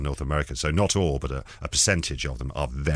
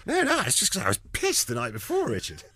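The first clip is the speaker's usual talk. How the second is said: innocent; 8 semitones higher than usual. Retold or imitated. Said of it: "completely innocently said".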